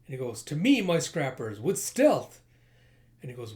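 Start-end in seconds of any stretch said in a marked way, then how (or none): 0.4-2.4 loud, hammed-up commander; rally the troops far too loudly; pompous rallying call. Blustering leader calling his scrappers to him, loud rally.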